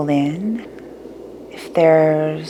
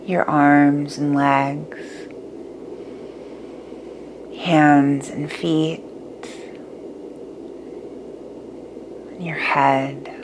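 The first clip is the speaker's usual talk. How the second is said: guided meditation; 6 dB quieter than usual. Acted.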